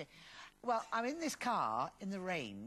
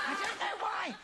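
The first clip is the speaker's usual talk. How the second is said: higher, louder, laughing through the words; 10 semitones higher, 4 dB louder than usual. Real, not acted. Actress recalls dumping him, laughing as she speaks. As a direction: giggle it out.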